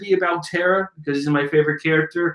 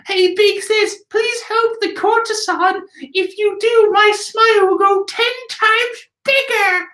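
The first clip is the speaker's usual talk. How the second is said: put-on much higher, sugary pleading kid voice; cutesy Otaku voice. Otaku begs Big Sis to help, high and cutesy. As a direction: beg big sister sweetly in a tiny high voice. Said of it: "we cut to Otaku going".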